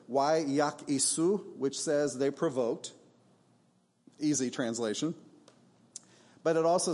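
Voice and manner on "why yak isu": slow "Why yak isu", each syllable drawn out in Hebrew; "Why yak isu" said slowly and deliberately; Hebrew word. Shown as a demonstration. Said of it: "two Hebrew words".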